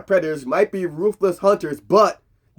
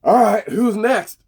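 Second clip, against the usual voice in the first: slow, higher, cocky dare; cool challenge; 6 semitones higher, 4 dB louder, slower than usual. Retold or imitated. Mike facing nine Predators, slow cocky challenge. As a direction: toss it out like a cocky hero. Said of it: "all Mike says is".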